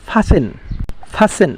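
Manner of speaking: ফাসেন said with a silent t, no t sound; correct pronunciation. Shown as a demonstration. Teacher showing students the right way, ফাসেন with silent t. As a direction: say ফাসেন, dropping the t entirely. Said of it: "শুদ্ধ উচ্চারণটা হবে কি?".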